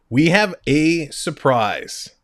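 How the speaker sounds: drawn out, slow showman reveal; slow teasing announcement. Shown as a demonstration. Host teasing viewers about a surprise guest, slow and drawn out. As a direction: stretch it out to build suspense. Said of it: "Ladies and gentlemen".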